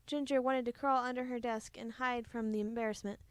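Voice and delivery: ordinary delivery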